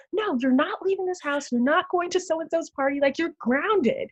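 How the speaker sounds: high-pitched mock parent yelling; retold parent yell. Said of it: "screaming at you".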